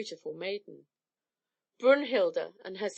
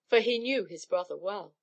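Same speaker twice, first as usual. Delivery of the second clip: ordinary delivery, nothing marked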